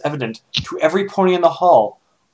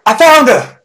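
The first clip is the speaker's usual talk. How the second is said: higher, louder call; 9 semitones higher, 8 dB louder than usual. Acted. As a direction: call back to the others. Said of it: "she called".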